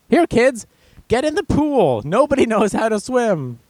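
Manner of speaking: put-on high-pitched parent calling the kids in; mock oblivious parent. Parent sending kids into the pool, high mock call.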